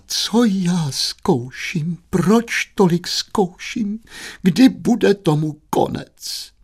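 high, slow, drawn-out wail; wailing lament. Acted. Mother bemoaning her suffering, high slow wail. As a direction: lament each question, high and long. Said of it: "Paní Štědrá lomila rukama".

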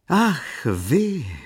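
friendly with a touch of condescension; a high ah, then a gracious you; familiar, condescending. Acted. Lady on horseback greets Jan Maria, warmly condescending. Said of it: "řekla důvěrně, přátelsky a trochu blahoskloně".

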